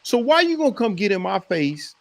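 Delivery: normal talking voice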